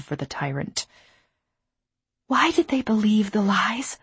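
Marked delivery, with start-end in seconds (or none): 2.3-4.0 whispered. Acted. Asks in anguish.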